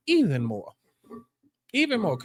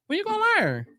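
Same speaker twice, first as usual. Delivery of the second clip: much higher, incredulous when you gonna learn; high exasperated scolding; exasperated high repeat; 14 semitones higher than usual. Real, not acted. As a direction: squeal it in disbelief.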